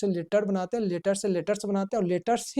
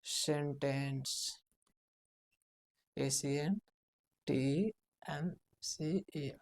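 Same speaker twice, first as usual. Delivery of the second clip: spelled out slowly; 3 semitones lower, 8 dB quieter, much slower than usual. Shown as a demonstration. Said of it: "word से ही sentence बनाते हैं".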